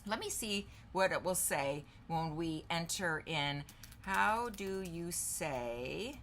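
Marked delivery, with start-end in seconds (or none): 4.0-6.2 slow, drawn-out words; spaced out at typing speed; dictating while typing. Real, not acted. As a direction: say it slowly as you type it. Typing a question while saying it.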